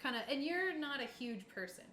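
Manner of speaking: ordinary talk, nothing marked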